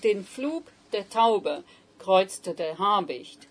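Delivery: slow German line, den Flug der Taube kreuzte der Habicht; reading German aloud. Shown as a demonstration. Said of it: "you've got den".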